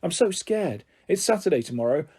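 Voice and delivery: usual speaking voice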